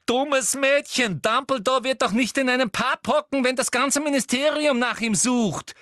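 high, loud, exasperated scolding; shrieking reprimand to a foolish pupil; crying out. A narrator's reading. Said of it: "rief Umbridge".

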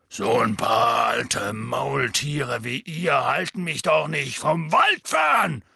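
slow and loud, building to a shout. A narrator's reading. Hagrid loudly defying the centaurs, slow and booming. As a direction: roar it at the old mules. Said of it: "sagte Hagrid laut".

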